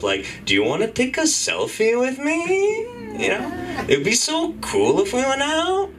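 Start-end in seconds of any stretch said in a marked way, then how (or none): 0.4-2.9 high rising tune on every phrase end; uptalk. Shown as a demonstration. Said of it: "it is when you end your sentences on a up". 3.9-5.9 high rising tune on every phrase end; uptalk. Shown as a demonstration. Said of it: "it is when you end your sentences on a up".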